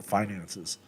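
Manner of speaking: ordinary talk, nothing marked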